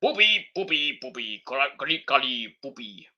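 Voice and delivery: a light parody of a giant wrestler's voice; mock Omos. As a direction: ham up a wrestler's bragging promo. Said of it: "He's just like".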